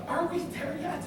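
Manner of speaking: put-on high-pitched kid in the back seat; whining child. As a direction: imitate an impatient child, high up. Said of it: "And the kids are like".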